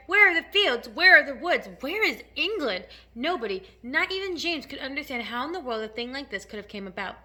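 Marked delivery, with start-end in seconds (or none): 0.1-2.9 loud string of desperate questions; bewildered cry. A narrator's reading. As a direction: cry out for the lost land. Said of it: "they cried".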